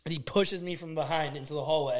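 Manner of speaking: ordinary talk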